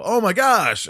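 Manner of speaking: loud, high mock-excited hello; re-enacted starry-eyed outburst; star-struck greeting. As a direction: gush as if thrilled to see them.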